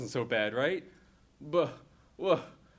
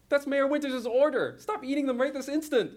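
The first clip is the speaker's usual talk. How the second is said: high, louder scold; 10 semitones higher, 4 dB louder than usual. Acted. April scolding Hammond for eating the tarts, sharp. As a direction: tell him off sharply.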